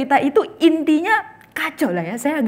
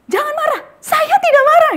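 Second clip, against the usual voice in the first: high, loud yell that he is not angry; man losing his temper at the steward; angry shout; 9 dB louder than usual. Acted. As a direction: explode while insisting you are calm. Angry man denies being angry.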